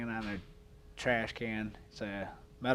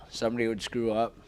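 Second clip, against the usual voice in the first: ordinary talk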